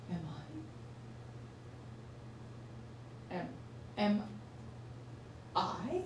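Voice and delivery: halting, hushed self-questioning; quiet, broken disbelief; hesitant dawning realisation. Acted. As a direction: stammer it softly with long pauses. Dawning realisation acted out before outburst.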